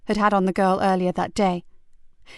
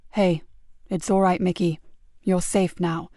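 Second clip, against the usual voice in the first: soothing; 2 semitones lower than usual. Acted. Sergeant soothing a terrified boy in hospital, gently.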